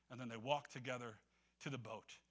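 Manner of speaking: ordinary talk, nothing marked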